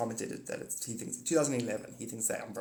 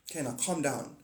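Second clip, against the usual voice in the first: normal talking voice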